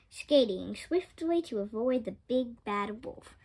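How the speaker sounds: plain reading voice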